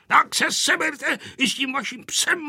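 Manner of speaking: put-on high, fussing old grandfather; ranting. A narrator's reading. Grandfather sending children and dog away, ranting. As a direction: rant in a high voice, without real shouting. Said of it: "povykoval dědeček".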